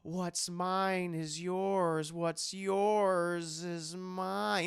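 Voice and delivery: slow, drawn-out mock villain murmur; slow mocking imitation of Woody Harrelson's Carnage; Harrelson impression. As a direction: drag each word like a hammy villain. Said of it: "talks like this".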